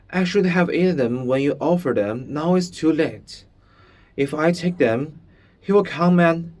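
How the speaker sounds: fast, high, frightened rush; words running together. A narrator's reading. Shmuel panicking about the lieutenant, rushed and high. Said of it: "his sentence running quickly together".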